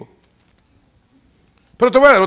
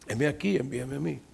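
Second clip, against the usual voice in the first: much softer, lower humble answer; quiet sincere reply; 4 semitones lower, 12 dB quieter than usual. Acted. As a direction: say it softly to yourself. Preacher repeats Isaiah quietly.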